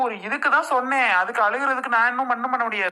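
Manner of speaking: high-pitched exasperated protest; exasperated husband snapping back at his wife; heated protest. Acted. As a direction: push back hard, voice climbing.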